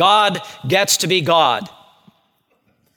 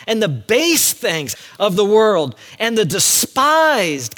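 forceful preaching; 7 semitones higher, 3 dB louder than usual. Acted. Preaches scripture at full force.

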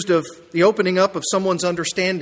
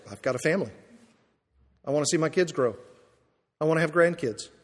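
softer, wistful listing of wants; soft mock objection; 3 semitones lower, 7 dB quieter, faster than usual. Preacher voicing a listener's excuse, much softer. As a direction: murmur the excuse like a comfortable churchgoer. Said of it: "Well, you say".

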